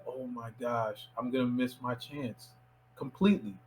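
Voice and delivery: very soft, low, defeated aside; quiet retold despair; hushed dismay. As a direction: think it aloud under your breath. Retold private panic at missing chance.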